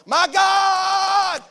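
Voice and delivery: slow, drawn-out, pleading mock prayer voice; mocking pious quote. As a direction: ham up a wailing believer claiming the verse. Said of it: "we quote Philippians four".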